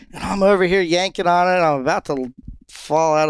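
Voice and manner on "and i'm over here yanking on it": ordinary talk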